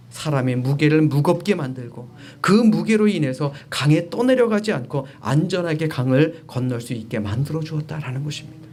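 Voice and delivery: usual speaking voice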